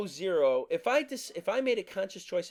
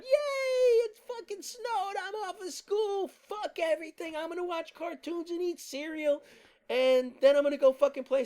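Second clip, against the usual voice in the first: put-on squealing much higher like an overjoyed child; thrilled kid; 12 semitones higher than usual. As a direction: shriek with snow-day joy, pitched way up. Voicing excited kid on snow day.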